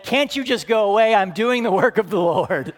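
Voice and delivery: loud, high exasperated outburst; fed-up parent pleading to be left alone. Acted. Pastor to his needy two-year-old, exasperated.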